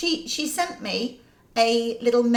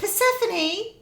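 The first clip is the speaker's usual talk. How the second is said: higher, drawn-out genteel call; well-to-do shopper calling; 9 semitones higher, slower than usual. Acted. Parent calls child in Waitrose, slow genteel call. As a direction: call the child slowly in an upmarket supermarket. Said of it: "one end of the spectrum or the other".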